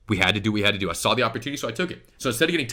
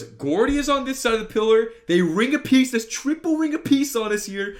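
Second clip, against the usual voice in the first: pitched way up, emphatic; high, strained disbelief at the play; alarmed recap; 11 semitones higher than usual. Real, not acted. Player showing viewers the team being surrounded, alarmed.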